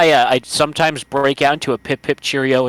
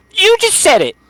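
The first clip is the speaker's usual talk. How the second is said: much higher and louder, crowing; gleeful gotcha; 5 dB louder than usual. Real, not acted. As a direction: throw the accusation back, laughing at him. Echoing the gotcha.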